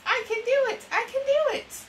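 a lightly voiced parrot echoing the line twice. The parrot in the story repeats after the children, high parrot voice. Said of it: "This parrot says".

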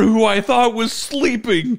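usual speaking voice

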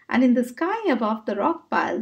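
ordinary delivery, nothing marked

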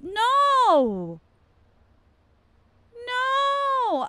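high, loud scream of No. Real, not acted. Streamer screaming at the game's cop. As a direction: shriek it in real panic.

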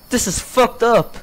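usual speaking voice

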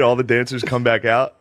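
ordinary talk

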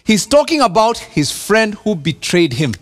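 normal talking voice

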